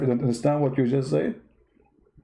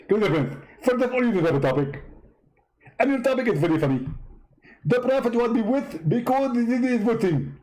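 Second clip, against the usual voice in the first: higher, mocking impression of Zakir Naik; 5 semitones higher than usual. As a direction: play the preacher as a parody, pitched up. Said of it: "how you talk like that".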